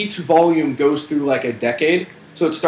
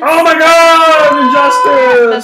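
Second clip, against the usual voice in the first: high, loud, drawn-out delight; excited squeal; 10 semitones higher, 9 dB louder, much slower than usual. Real, not acted. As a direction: gush over a bargain, loud and slow. Excited about cheap game.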